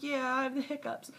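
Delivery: lightly voiced little girl with hiccups; hiccupy child voice. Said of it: "She's like".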